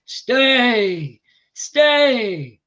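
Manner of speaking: loud, high drawn-out cry; king's challenge. A narrator's reading. Shalva calls after Bhishma, loud challenge. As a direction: call it out loud and high, falling at the end. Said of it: "The king told Bhishma".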